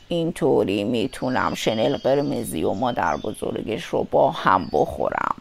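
deep, quiet scheming wolf; wolf talking to himself. A narrator's reading. Wolf plans to eat both of them, low muttering. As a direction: plot it softly, very low. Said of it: "گرگ با خودش گفت".

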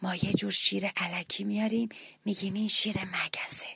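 whispered secret; whispering. A narrator's reading. Hero whispering the plan to the little mouse. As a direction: whisper the trick so the lion cannot hear. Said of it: "بعد آهسته گفت".

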